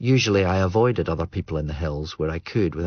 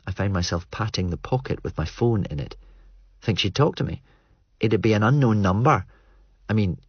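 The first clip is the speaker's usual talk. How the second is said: ordinary delivery, nothing marked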